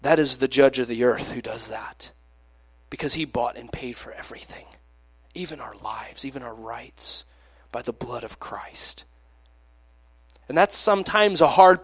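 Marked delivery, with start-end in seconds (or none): 1.3-9.0 whisper. Real, not acted. Hushed sermon conclusion.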